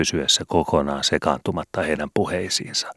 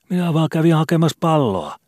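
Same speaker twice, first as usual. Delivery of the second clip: put-on a much higher, meek young boy's voice; modest boy; 13 semitones higher than usual. Skobe answers the landlady meekly, as a timid boy. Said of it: "sanoi Skobe vaatimattomasti".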